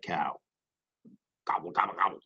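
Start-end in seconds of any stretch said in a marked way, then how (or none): 1.5-2.2 grumbled gobble. Acted. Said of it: "grumbled Turkey".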